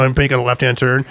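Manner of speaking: ordinary talk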